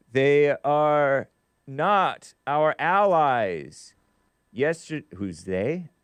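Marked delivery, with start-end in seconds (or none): 0.1-3.9 slow, drawn-out reading. Retold or imitated. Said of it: "Manuel, five coffees".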